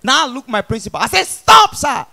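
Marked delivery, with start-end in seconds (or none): 1.2-2.1 sudden high, loud cry; protesting shout. Acted. Pupil begging principal to stop flogging, shouted protest.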